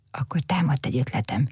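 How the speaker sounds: ordinary delivery, nothing marked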